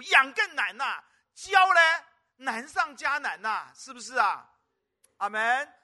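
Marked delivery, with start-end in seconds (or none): 0.0-3.7 loud, slow, drawn-out preaching; full-force preaching. Acted. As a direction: hammer each phrase slowly from the pulpit. Preaching emphasis on child-rearing.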